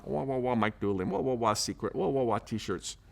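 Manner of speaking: soft, muffled wah-wah talk like a distant trombone; Charlie Brown adult voice. Retold or imitated. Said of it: "I could hear the Charlie Brown".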